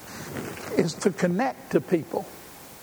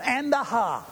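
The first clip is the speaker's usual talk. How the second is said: mock gasping preacher, much higher and slow; breathless preacher; 7 semitones higher than usual. Mimicking out-of-breath preachers, gasping hammed-up "ha". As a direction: ham up a winded preacher's "and a ha". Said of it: "they got in an".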